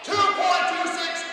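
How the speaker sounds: loud, drawn-out, high-pitched score call; triumphant announcement. Real, not acted. Champion announcing his record time to the contestants, triumphant shout.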